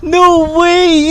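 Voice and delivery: loud, high, screaming with joy; overjoyed yell, pitch far up; ecstatic scream. Real, not acted. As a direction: shriek it out in disbelief. Stunned by the donation.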